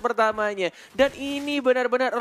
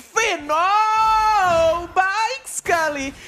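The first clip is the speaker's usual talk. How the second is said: sudden high shout of excitement; excited shout; 11 semitones higher, 7 dB louder, much slower than usual. Real, not acted. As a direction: yell it out as the play lands. Caster reacts to a big play. (teriakan caster yang heboh)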